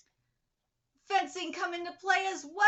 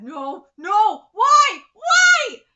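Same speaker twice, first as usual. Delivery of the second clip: loud pained outcry, going higher; anguished shout; 6 semitones higher, 17 dB louder, much slower than usual. Real, not acted. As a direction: yell in real disbelief. Dismayed outburst at the plot.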